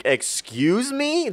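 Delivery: drawn-out, rising disbelief; incredulous. Retold or imitated. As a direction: stretch it high, as if you can't believe the accusation. Retells her incredulous reply to accusation.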